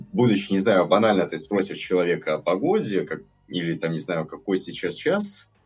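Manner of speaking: normal talking voice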